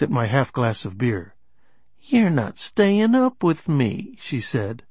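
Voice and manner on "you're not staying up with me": high, slow, drawn-out tease; tipsy complaint. A narrator's reading. Sloshed woman chiding him over his beer, tipsy. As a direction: pitch it high and drag the words.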